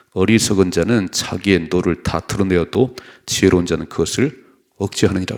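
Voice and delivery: loud, steady unison reading of the verse; scripture read aloud. Real, not acted. Pastor leads the congregation in reading, loud and steady. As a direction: read the proverb out together, loud and even.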